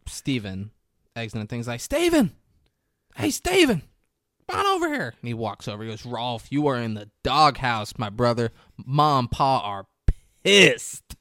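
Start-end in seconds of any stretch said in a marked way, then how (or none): 1.8-5.1 high shouted call across a lot; loud high hail. Retold or imitated. Calling out across parking lot. 6.1-11.2 louder teasing scold; a hint of a stern big brother; mock stern brother.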